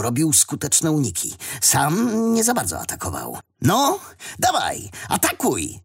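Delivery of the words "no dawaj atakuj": mental shout. A narrator's reading. Wadim goading his friend in thought to attack, urgent cry. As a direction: egg him on with a high, stretched cry. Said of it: "krzyknął w myślach Wadim".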